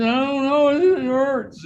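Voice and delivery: dad mimics his daughter's pained complaint in a high voice; imitating a hurt child. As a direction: play the little girl complaining that it hurts. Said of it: "She said".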